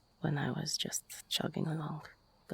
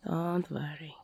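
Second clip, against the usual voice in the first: ordinary talk, nothing marked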